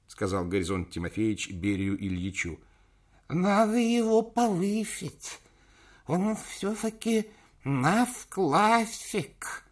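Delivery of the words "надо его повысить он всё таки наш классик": put-on high, slow toothless old man; toothless mumble. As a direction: mumble through no teeth. Old chairman voiced.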